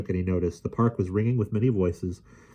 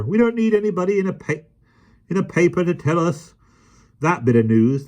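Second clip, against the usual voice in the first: scoffing; 11 semitones higher, 5 dB louder than usual. A narrator's reading. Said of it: "scoffed the park keeper".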